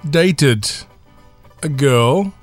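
ordinary delivery

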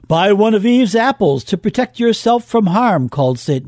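ordinary delivery

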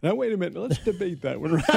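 high, laughing pretend protest; amused mock objection. Real, not acted. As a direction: play along with the joke, laughing. Joking about Lucky Charms.

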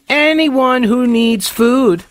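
mock earnest. Mocking earnest Vox quote.

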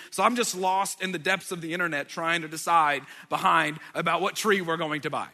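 ordinary talk, nothing marked

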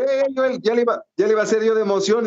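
high, fast excited retelling; excited rush. Retold or imitated. Reporter recalls bumping into Chávez, excited. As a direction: blurt it out fast, thrilled.

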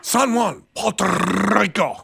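put-on over-the-top Spanish accent. Host mocking reporters' sign-offs, hammed-up Spanish accent. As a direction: roll the R in a showy Spanish accent. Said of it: "the perfect English-speaking reporter".

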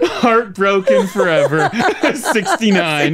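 slow, laughing tease. Real, not acted. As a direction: drag it out through laughter. Teasing over old email names.

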